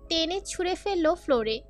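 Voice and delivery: ordinary delivery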